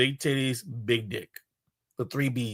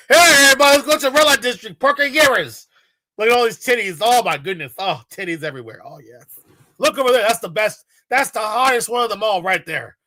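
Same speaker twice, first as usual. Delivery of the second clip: rally the group like a sleazy guide; mock tour guide; 5 dB louder, faster than usual. Mocking the guide leading the red-light tour, loud and hyped.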